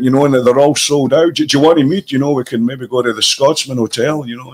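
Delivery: lightly voiced film star; casual invitation in an actor impression; Sean Connery. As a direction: imitate a famous actor making plans. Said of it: "And he went".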